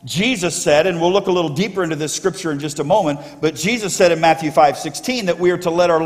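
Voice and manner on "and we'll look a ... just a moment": usual speaking voice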